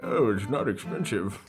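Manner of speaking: low, slow put-on voice; mock straight-faced claim. Owner pretending to viewers that flying is cheap, mock-serious. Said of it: "can't honestly say with a straight face".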